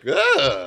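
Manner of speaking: high loud disgusted ugh; disgusted groan. Retold or imitated. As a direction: groan like you can't stand it. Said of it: "and I'm like".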